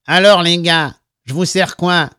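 nasal, lightly voiced bartender. A narrator's reading. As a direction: greet customers through the nose. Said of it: "avec sa voix nasillarde".

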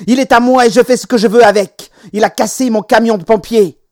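put-on high, loud outraged child; cried out. A narrator's reading. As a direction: keep shouting the grievance at a high pitch. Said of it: "s'écria Peter".

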